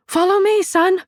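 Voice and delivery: loud, high call to her son; shouted. A narrator's reading. Mother calling her son over the station crowd, shouting. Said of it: "and shouted".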